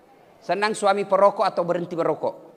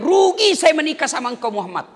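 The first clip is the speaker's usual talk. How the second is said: lantang; 8 semitones higher, 6 dB louder than usual. Acted. Preacher voicing wives' quote.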